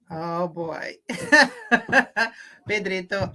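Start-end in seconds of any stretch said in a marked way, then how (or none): none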